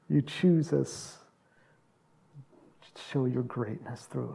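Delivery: hushed closing prayer. Acted.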